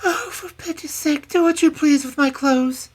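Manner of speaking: very high, miserable cry; miserable princess. A narrator's reading. Princess surrendering her clothes to the hag, miserably. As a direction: give in wretchedly as a high-voiced princess. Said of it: "cried poor Celandine miserably".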